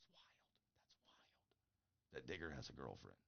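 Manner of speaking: hushed secret whisper, slowing at the end. Real, not acted. Proud dad whispering about his son's girlfriend.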